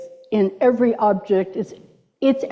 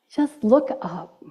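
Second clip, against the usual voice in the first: soft cue; 2 semitones lower, 5 dB quieter, slower than usual. Shown as a demonstration. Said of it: "practice again".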